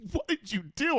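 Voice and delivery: high-pitched, incredulous friend; shocked friend. Retold or imitated. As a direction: squeak it in disbelief like the stunned friend. Friend reacts to owed money.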